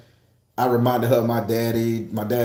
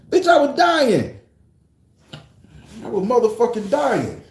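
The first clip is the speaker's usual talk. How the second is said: exaggerated wail; 8 semitones higher than usual. Real, not acted. Recalling the bruises.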